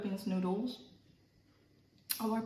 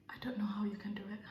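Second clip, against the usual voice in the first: fast whisper; 5 dB quieter, much faster than usual. Real, not acted. Overwhelmed by spice.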